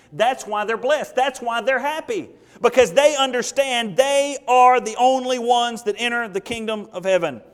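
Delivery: forceful preaching. Real, not acted. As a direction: drive each word home to the congregation. Preacher drives the point home.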